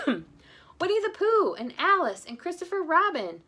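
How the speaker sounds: high, slow insistent character voice. Acted. A character insisting on their names, high and slow.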